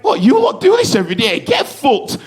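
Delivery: cocky scoff. Retold or imitated. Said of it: "in my head, I was like".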